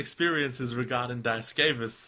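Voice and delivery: thick mock-American accent; exaggerated American accent. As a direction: read it as American as possible. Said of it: "read this in the most American accent possible".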